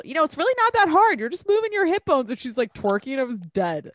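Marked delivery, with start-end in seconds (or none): 0.2-2.3 much higher, bubbly instructor tone; mock coaching voice. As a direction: chirp it like a twerk teacher. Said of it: "she's giving Hazel a tutorial".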